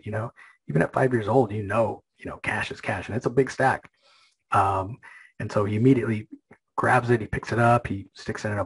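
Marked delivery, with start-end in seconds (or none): none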